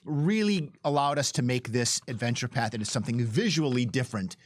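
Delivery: usual speaking voice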